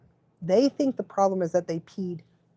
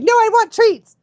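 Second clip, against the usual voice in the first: high, loud pretend dog voice; voicing the dog; 12 semitones higher, 7 dB louder than usual. Owner voicing her dog asking for treats, high. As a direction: demand treats as the cheeky dog. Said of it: "she's like".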